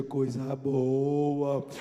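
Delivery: slow, low, drawn-out "Coisa boa"; savoring "coisa boa". Retold or imitated. Son relishing his mother's news, slow and low.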